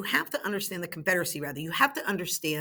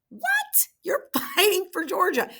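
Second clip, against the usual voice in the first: incredulous what; 11 semitones higher than usual. Pretend. Teacher mocks Georgia's militia choice.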